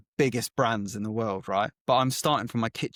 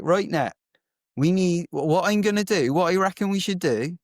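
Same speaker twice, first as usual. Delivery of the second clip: a light, higher and louder Bristolian imitation; light Bristolian accent, pitched up and louder; 6 semitones higher, 4 dB louder than usual. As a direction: mimic Phil's thick Bristolian twang, pitched up. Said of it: "he had this really thick Bristolian accent".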